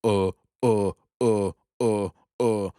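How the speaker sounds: mock stammering viewer; mocking clueless stammer. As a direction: imitate someone lost for an answer. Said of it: "You're gonna be like".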